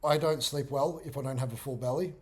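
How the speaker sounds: ordinary talk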